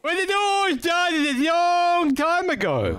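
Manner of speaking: high, slow, drawn-out recounting; stretched-out once-upon-a-time delivery; grand storyteller. Acted. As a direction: begin a tale with hammed-up grandeur. Launches a mock epic flashback.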